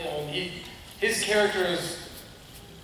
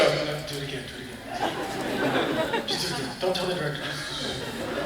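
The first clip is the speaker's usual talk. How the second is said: hushed, urgent stage whisper; secret whisper; 4 semitones lower, 9 dB quieter than usual. Retold or imitated. Co-star secretly urging actor to redo a take, whisper. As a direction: whisper it so only your co-star hears. Retold secret cue, hidden from director.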